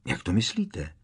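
ordinary delivery

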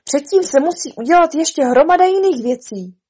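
loud, high, insistent objection; brisk interruption. Acted. Rabbit corrects the king on procedure, brisk and insistent. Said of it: "skočil mu rázně do řeči králík".

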